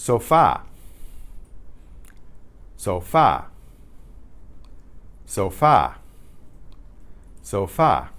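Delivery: sofa said so-FA, Spanish style; last-syllable stress. Shown as a demonstration. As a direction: punch the final syllable of Sofa. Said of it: "why is the stress on that last syllable".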